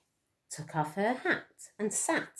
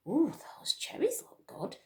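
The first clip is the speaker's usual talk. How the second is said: breathy little bird whisper; lightly voiced little bird; hushed birdlike chirp; 6 semitones higher than usual. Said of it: "they chirped".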